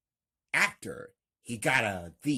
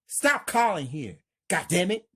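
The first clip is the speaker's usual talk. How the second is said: higher, louder angry snap; upset outburst; 7 semitones higher, 5 dB louder, faster than usual. Acted. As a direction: tell her off down the phone. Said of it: "Marcus is really upset".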